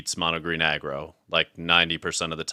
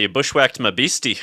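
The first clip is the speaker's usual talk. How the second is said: higher, louder mock Scottish accent; 5 semitones higher, 5 dB louder than usual. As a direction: ham up a Scottish accent for fun. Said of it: "I have a Scottish accent".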